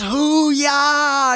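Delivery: high, drawn-out war cry. Shown as a demonstration. SEAL showing the teams' war cry, rousing. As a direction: belt out a military cheer. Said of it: "our war cry is".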